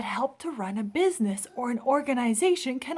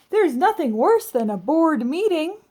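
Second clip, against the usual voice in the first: emphatic sympathy; 6 semitones higher, 8 dB louder than usual. Acted. Amelia's sympathetic exclamation.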